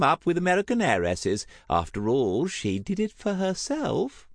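high, swooping society lady; theatrical high-pitched gossip; effusive gossiping aunt. Acted. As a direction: gush with relish over every detail. Voices gossiping Aunt Tessa.

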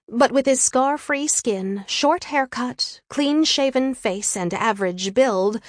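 plain reading voice

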